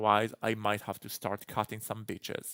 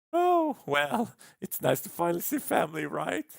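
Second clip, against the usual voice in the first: high, quick chirpy character voice; squeaky-high acted small talk; put-on character voice; 9 semitones higher, 6 dB louder than usual. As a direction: jump up high and rattle it off. Voicing a game character.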